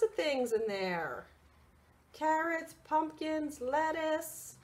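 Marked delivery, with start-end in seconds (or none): none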